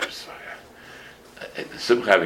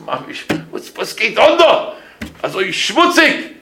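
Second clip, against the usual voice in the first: rosh yeshiva's question; 11 semitones higher, 3 dB louder, slower than usual. Retold or imitated. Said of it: "he says to me".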